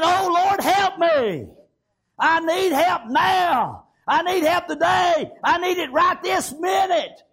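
urgent preached plea, drawn-out words; crying out to God. Acted. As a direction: cry out for help in full sermon voice. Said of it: "he began to cry out".

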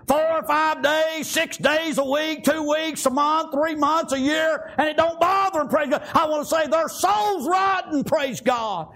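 high-pitched, urgent revival preaching; impassioned country preacher in full flow; fervent preaching. Real, not acted. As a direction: exhort the church in driving bursts.

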